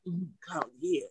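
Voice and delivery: soft mock bedroom murmur; lip-biting lover. Woman imitating dudes in bed, mock-sultry. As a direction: imitate a man moaning through a bitten lip. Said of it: "they be biting their lip like".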